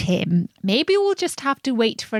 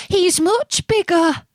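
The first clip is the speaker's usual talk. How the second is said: much higher, small squeaky goat; tiny goat voice; 10 semitones higher than usual. A narrator's reading. Little goat's version of line.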